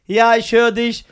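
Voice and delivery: drawn-out reply from outside; father answering his child through the door; calling out. Retold or imitated.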